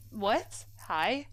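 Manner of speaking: normal talking voice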